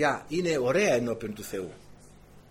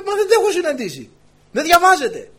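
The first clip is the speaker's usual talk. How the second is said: excited retold protest; 13 semitones higher, 8 dB louder than usual. Student protesting to professor.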